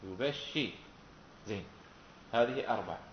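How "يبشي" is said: يبشي as yu-bash-shi, falling at the end; doubled-sheen reading. Shown as a demonstration. Teacher repeating the doubling, يبشي as yu-bash-shi. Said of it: "مع التشديد".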